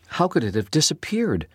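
ordinary delivery

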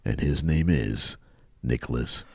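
low, slow mock-sultry praise; slow seduction for the ladies. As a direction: croon the praise with long pauses. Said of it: "read this a little slower for the ladies".

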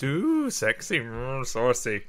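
breathy mock-saucy purr. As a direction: tease it like a flirt. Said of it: "kind of way".

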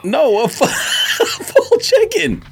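ordinary delivery

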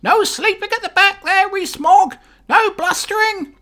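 very high, loud squawking parrot voice; Boris the parrot. Acted. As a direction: play the parrot calling the Commons to order. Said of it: "you're gonna hear Boris the parrot".

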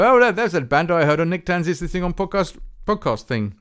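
lightly voiced eager fan; a hint of an excited new fan; imagined listener's remark. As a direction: play a listener who just recognised the band. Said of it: "click on them and go".

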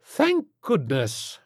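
high, slow, grand relief; drawn-out society-lady relief; relieved rich matron. A narrator's reading. Rich matron relieved at rescue news.

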